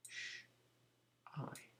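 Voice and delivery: soft SH hiss, pause, then soft I as "eye"; sounding out. Shown as a demonstration. Teacher checking sound buttons in shy, SH and I sounded apart. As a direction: say SH and I separately and softly. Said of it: "the sound button is sh".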